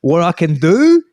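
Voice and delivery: high, loud, belligerent mock outrage; aggressive Aussie bloke. Australian man answering a stranger's complaint, hammed-up aggression. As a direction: puff up like a bloke spoiling for a fight. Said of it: "he's gonna go".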